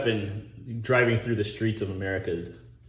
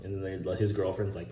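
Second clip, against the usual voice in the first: normal talking voice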